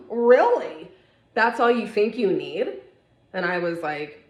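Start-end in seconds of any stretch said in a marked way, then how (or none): 0.1-2.8 loud, high mock disbelief, "Really?" thrown out; incredulous sister. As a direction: scoff at the tiny trim request. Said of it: "my sister goes".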